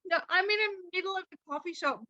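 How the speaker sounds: high-pitched, overwhelmed retelling; mock-tearful and high; retold bawling. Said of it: "bawling my eyes".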